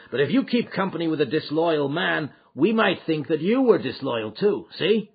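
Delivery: a threat wrapped in friendly words; voiced Boss McGinty. McGinty warns McMurdo.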